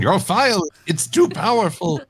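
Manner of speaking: overly dramatic error message, high pitch; mock computer message voice. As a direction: announce it like a pompous system alert. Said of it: "Discord saying".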